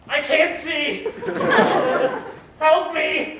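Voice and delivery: high, loud, frantic cries; panicked yelling. Acted. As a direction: shout for help in a panic. Blind character panics.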